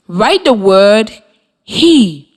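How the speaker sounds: slow, clear dictation of the word "he". Shown as a demonstration. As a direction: say "write the word he" slowly, leaning on "he". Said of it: "you will write the sight word I tell you".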